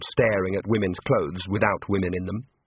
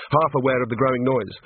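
ordinary delivery

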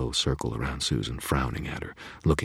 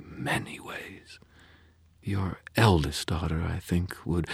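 menacing faerie; 5 semitones higher than usual. A narrator's reading. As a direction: savor the threat. Leah voiced by narrator.

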